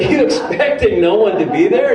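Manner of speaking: can barely ask it for laughing; laughing question. Retold or imitated.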